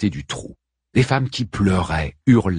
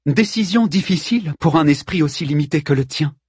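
measured put-down, pitched up; composed retort; 7 semitones higher than usual. A narrator's reading. Queen answers looter's threat, composed scorn. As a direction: answer the insult with poise. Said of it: "répondit posément Pentésilée".

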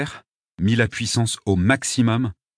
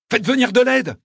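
high, loud, desperate plea; urgent shout; 14 semitones higher, 5 dB louder than usual. A narrator's reading. Narrator begging the crowd for help, shouting. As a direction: yell for help at full urgency. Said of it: "leur criai-je".

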